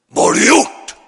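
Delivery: furious high shout; neighbour shouting. Retold or imitated. Angry neighbour yelling at the pastor. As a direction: bellow it like an enraged giant. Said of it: "stod han och skrek åt".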